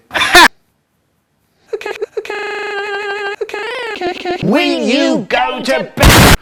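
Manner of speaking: high, drawn-out fed-up mum; exasperated mum. Retold or imitated. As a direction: plead then shout like a tired mum. Mum voiced in story.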